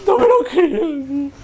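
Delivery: very high, mock-tearful moan; whining disbelief. Streamer can't believe the game's bad luck, high whine. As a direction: wail it in disbelief.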